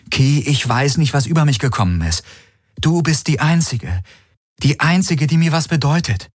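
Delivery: high, pleading voice; pouring out desperation; anguished plea. Acted. Galen wants to cry out to Kirai, pleading.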